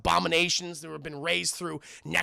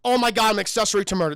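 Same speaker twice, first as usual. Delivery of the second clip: loud, raised mock horror, then racing on; exaggerated retold panic; mock panicked outburst; 2 semitones higher, 8 dB louder than usual. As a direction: act out a horrified realization. Retold panicked movie reaction.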